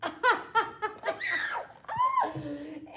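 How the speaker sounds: bright laugh. Real, not acted. Reader laughs.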